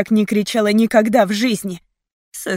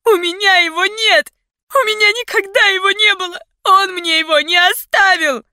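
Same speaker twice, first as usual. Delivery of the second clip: high, loud, tearful pleading; screaming in despair; 12 semitones higher, 5 dB louder than usual. A narrator's reading. Heroine denying she has the code, desperate wail. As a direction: wail it at the captors. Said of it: "кричала я в отчаянии".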